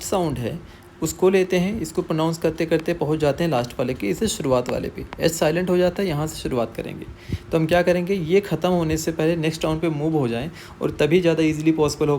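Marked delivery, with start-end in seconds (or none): none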